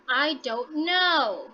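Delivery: slow, KNOW drawn out and falling at the end; falling intonation. Shown as a demonstration. Teacher showing students a falling sentence ending, falling intonation. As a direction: stretch "know" and let its pitch drop. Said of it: "your pitch is going to be falling".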